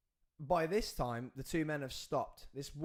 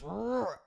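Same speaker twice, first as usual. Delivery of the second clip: mock roar; 7 semitones higher, 4 dB louder than usual. Attacker's growl imitated.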